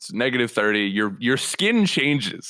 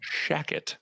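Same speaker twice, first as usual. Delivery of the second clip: whispered word; 4 semitones higher, 8 dB quieter than usual. Pretend. Host coins slang word.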